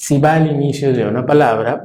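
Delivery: ordinary delivery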